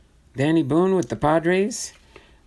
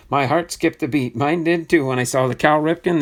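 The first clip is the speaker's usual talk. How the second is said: usual speaking voice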